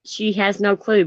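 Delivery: ordinary delivery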